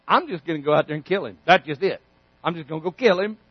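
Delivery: soft, low, fast shrug; casual David. Acted. David tells everyone he'll just go kill Goliath, offhand and quick. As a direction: toss it off like it's nothing. David nonchalant about killing.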